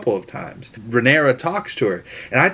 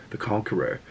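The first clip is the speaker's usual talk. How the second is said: hushed; 3 semitones lower, 10 dB quieter than usual. Retold or imitated. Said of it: "And she goes".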